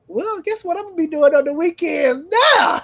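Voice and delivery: high, loud teasing question; playful taunt. Retold or imitated. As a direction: ham up the guilty pleasure. Joking about skipping the Fiesta.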